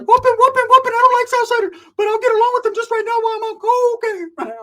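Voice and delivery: very high squeal, loud; squeaky falsetto rant from a coked-up friend; squeaky coked-up Pepe. Retold or imitated. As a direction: squeal like you're wired on coke. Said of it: "Pepe's like".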